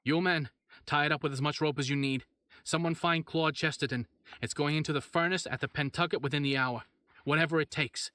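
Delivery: rally the policemen with loud orders; mayor shouting orders to the police, loud; shouted orders. Acted. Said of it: "the mayor shouted".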